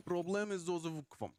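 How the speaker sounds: put-on playful Russian accent, lightly done. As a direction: drop into a jokey Russian accent. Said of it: "you don't normally do a Russian accent".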